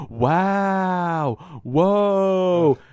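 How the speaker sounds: high, loud gasping wows, imitating an excited kid; kid's excited wows. Imitating a teen in the theater gasping at cameos. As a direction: gush over every cameo like a wide-eyed teen. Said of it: "he would go".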